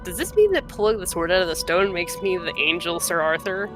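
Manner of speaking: ordinary talk, nothing marked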